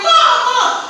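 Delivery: retold panicked yelling for mom. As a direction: scream for mom, jolted awake. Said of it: "he woke up screaming".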